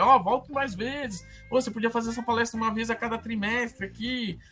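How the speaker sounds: high, eager invitation; enthusiastic audience. Retold or imitated. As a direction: imitate keen listeners urging a return. Quoting enthusiastic audience feedback.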